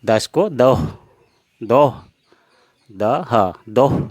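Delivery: दोह said with a breathy h at the end, not दस; Assamese doh. Shown as a demonstration. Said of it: "असमिया में पढ़ेंगे दोह". (असमिया में दोह, साफ़ उच्चारण)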